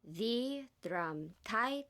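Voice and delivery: "drum-tight" very slow, word by word; stressing alternate words. Acted. Actor obeying director's odd note, "drum-tight" stressed word by word. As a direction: drag out "The drum-tight" with deliberate alternating emphasis. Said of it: "emphasize every other word".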